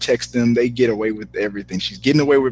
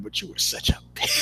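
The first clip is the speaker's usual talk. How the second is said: breathy whispered aside, much higher; mock whisper; 9 dB quieter than usual. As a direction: hiss it like a catty secret. Casting director's mock insult.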